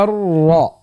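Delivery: thick R, held and drawn back; slow, backed R; heavy ra. Shown as a demonstration. Teacher demonstrating on video, ra thick and inward. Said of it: "صوت مفخم".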